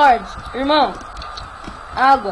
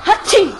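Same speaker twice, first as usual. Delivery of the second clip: playful mock sneeze; fake sneeze; 4 semitones higher than usual. Kid fakes a sneeze to seem sick.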